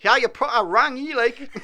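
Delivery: high, loud Geordie put-on voice; broad Geordie. Shown as a demonstration. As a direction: ham up a Geordie line. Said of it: "use it in a sentence".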